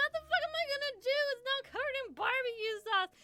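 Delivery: very high, very fast frantic words; mock panic. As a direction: rattle it off in panic. Panicking over the eye.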